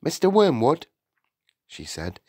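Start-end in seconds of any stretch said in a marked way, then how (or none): 0.0-0.9 higher, louder, exasperated teacher; mounting impatience; losing patience. A narrator's reading. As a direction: address him sharply, patience running out. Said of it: "began to lose patience".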